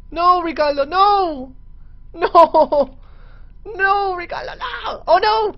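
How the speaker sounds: high desperate wail; anguished shout. Real, not acted. Pet dying.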